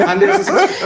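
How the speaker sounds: laughing through the words, pitched well up; chuckling while announcing success. Real, not acted. Radio host laughing as he tells callers it worked.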